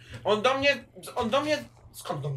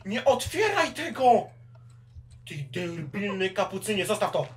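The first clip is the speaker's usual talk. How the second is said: frantic yelling; 5 semitones higher, 12 dB louder than usual. Real, not acted. Villager about to open chest.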